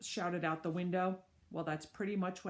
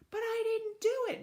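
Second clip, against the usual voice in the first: high protest; 4 dB louder than usual. Acted. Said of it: "Mister Klutz protested".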